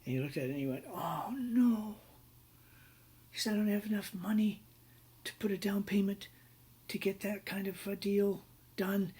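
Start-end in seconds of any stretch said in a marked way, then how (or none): 0.9-2.0 high-pitched mock "oh no" of dread; retold alarm, pitched well up; dismayed. Client reads an alarming fax. 3.5-9.1 retold panic. Client panics over unaffordable deal.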